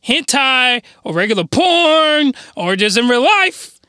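ordinary talk, nothing marked

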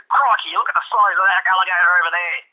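loud, high mock Australian accent; hammed-up Aussie wildlife-show exclaiming; Steve Irwin. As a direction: do a big, over-the-top Australian accent. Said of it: "like to see him try some, uh, Steve Irwin".

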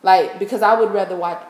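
normal talking voice

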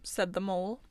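plain reading voice

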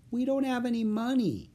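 put-on high, little child's voice; small voice. A narrator's reading. Annie admitting to the bird seller, small voice. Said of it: "said in a small voice".

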